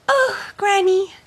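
put-on high bright child voice, eager; little girl voice. Child character speaking.

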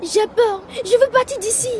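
trembling with fear; scared child. Acted. Boy hiding scared of robbers. (enfant qui a peur)

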